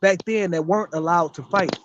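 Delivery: ordinary talk, nothing marked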